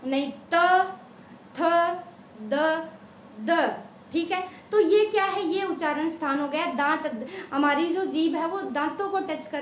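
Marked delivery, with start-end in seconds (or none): 0.5-3.8 त, थ, द sounded one by one against the teeth; sound demo. Shown as a demonstration. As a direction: pronounce त थ द slowly. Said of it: "फिर बोलो".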